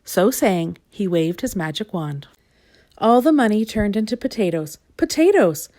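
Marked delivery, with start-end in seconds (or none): none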